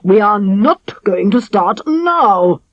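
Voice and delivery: slow, firm and fierce; fierce king. A narrator's reading. King fiercely refusing to let his daughter stay unwed. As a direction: lay it down as final. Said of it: "looking fiercer than ever".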